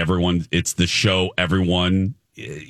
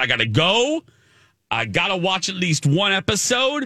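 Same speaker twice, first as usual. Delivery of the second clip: excited; 10 semitones higher than usual. Retold or imitated. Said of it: "I said".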